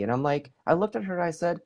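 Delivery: ordinary talk, nothing marked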